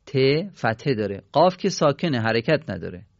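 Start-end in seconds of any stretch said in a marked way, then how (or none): none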